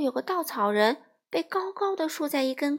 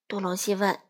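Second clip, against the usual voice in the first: usual speaking voice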